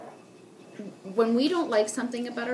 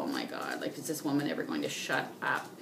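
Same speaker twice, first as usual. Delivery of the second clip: low, softer aside in exasperation; mock exasperation; 7 semitones lower, 5 dB quieter than usual. Customer enduring a seller's endless talk, exasperated. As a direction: sink low like a fed-up customer. Acted customer's weary private thought.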